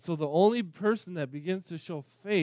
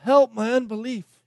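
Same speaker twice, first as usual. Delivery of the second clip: high, loud pleading cry; 6 semitones higher, 7 dB louder than usual. Retold or imitated. Disciples crying out to Jesus in their helplessness, loud plea. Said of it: "should have been crying out".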